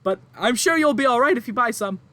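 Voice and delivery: high, loud, tearful mother pleading; distraught farm woman. Acted. Farm woman to adventurers, upset and near tears. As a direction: play the frantic farmwife near tears.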